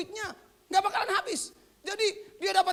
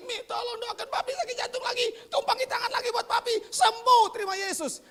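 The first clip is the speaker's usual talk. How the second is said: high, pleading voice of a sick father, rising to a shout; sick father calling to his wife for prayer, high and pleading; 7 semitones higher than usual. Retold or imitated. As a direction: beg your wife to pray for you, voice up high. Father begging mother to pray.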